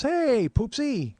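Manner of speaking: high, slow, sing-song hey poopsie; high slow cooing pet name to his mother-in-law; sweet greeting. Retold or imitated. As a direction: coo it like greeting a child. Dan greets mom sweetly.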